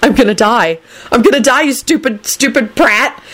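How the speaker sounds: high, urgent outburst; panicked, high-pitched fury; frantic. A narrator's reading. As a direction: curse him in rising panic. Frantic choking thoughts, cursing Lupin.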